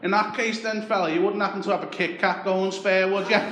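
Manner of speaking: butter him up like a chancer on the doorstep; Scouse beggar voice. Retold or imitated. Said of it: "he turns around and goes".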